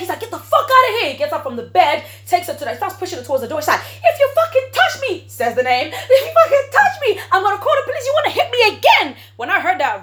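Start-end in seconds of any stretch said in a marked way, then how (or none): 0.2-1.1 high, loud mock shout; angry order. Boyfriend ordering the girl out. 4.0-5.2 very high, loud imitation of the girl; furious warning. Girl threatening man pushing her out. 5.9-9.2 very high, loud imitation of the girl; furious warning. Girl threatening man pushing her out.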